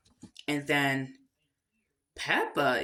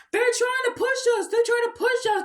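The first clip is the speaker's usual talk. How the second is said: high, loud, hurried cartoon kid voice; fast, high-pitched play-acted protest; Pedro explaining; 5 dB louder, faster than usual. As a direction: squeal out a frantic mock complaint. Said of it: "Pedro is trying to explain".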